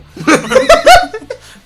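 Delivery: carcajada aguda y fuerte. Real, not acted. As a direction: estalla de risa, muy agudo. Carcajada por el chiste escatológico. (risotada real)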